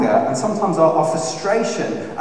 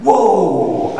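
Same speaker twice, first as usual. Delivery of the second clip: startled whoa; 5 semitones higher, 4 dB louder than usual. Retold or imitated. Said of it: "in the car by myself going".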